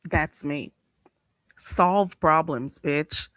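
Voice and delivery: ordinary talk, nothing marked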